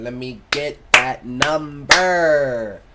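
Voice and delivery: slow, drawn-out smooth talk; slow flirtatious pickup line; flirty. Acted. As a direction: lay on the charm slowly.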